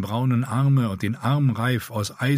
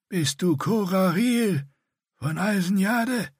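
higher, slow, strained rasping; painful rasp; 10 semitones higher, slower than usual. A narrator's reading. Scarred Bretan scolding Dirk, higher slow strained rasp. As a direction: force every word out painfully. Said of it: "jedes Wort zur schmerzhaften Anstrengung".

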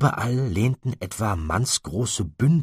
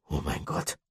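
low, soft and awed; stunned murmur; 3 semitones lower, 7 dB quieter than usual. Acted. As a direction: whisper it again in disbelief. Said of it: "wiederholte Chester". (leises, ehrfürchtiges Murmeln)

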